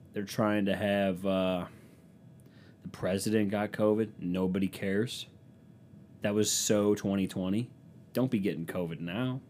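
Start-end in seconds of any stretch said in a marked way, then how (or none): none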